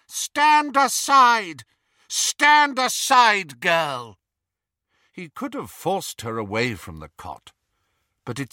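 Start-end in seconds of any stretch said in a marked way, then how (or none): 0.1-4.2 slow, loud, menacing order; cold command. Acted. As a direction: command her out of the way. Dark wizard threatens mother.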